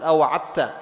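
أوعظت said "awa'atta"; wrong merged Dha. Shown as a demonstration. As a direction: swallow the ظ of أوعظت into a doubled ت. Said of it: "ما تقول".

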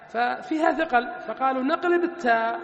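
usual speaking voice

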